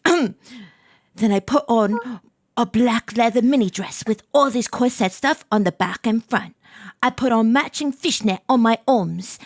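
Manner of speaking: put-on amateur British storytelling accent. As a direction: read on as a British student. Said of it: "I gotta do my British accent".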